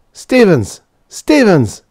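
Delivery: high, loud shout of a name; urgent calling out. A narrator's reading. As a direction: shout through a locked door to rouse someone. Hammering on door, calling colleague.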